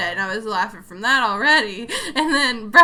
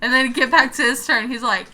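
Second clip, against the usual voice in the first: usual speaking voice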